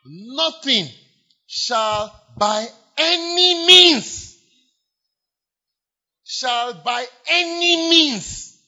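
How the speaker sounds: slow, high, ringing preacher's cry; proclaiming loudly. Acted. Preacher crying out the promise to the congregation, slow and soaring. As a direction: stretch every word out like a proclamation.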